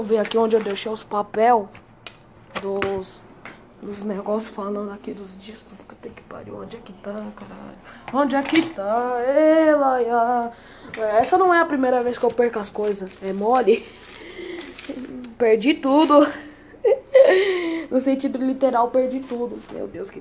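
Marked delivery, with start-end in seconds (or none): 2.6-7.8 whispering to himself while searching; whispered cursing under his breath. Real, not acted. Searching for papers, muttering. 16.8-17.9 laughing. Real, not acted. Laughs at losing everything.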